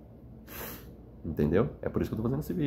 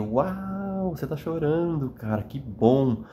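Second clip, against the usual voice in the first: higher, bright delighted reaction; light mock enthusiasm; 7 semitones higher, much slower than usual. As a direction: act out cheering someone on for crying. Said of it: "A frase correta seria".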